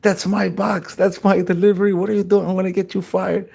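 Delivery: high, indignant imitation of outraged customers; acted-out outrage, held high throughout; mock flipping out. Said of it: "the people start flipping".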